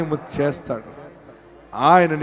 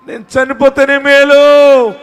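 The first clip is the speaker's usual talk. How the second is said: loud, high wail drawn out slowly; acted despairing cry; 10 semitones higher, 13 dB louder than usual. Preacher voicing a despairing believer who wants to die, loud wail. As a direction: wail it out in mock anguish, stretching the end. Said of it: "అని ఏడుస్తున్నావా?".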